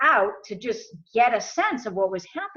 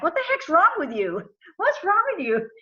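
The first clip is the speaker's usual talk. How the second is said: pitched-up startled demand; alarmed disbelief; 8 semitones higher than usual. Retold or imitated. Retelling to the listener how he confronted his sister, high and alarmed. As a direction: voice jumps high in shocked confusion. Sister whispering in dark room.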